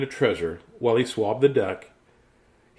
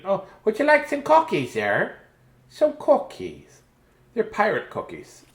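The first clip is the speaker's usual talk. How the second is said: high, sing-song sweet offer; 4 semitones higher than usual. Acted. Small Saul offering the lady cookies, high sweet voice. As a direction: coax them with treats.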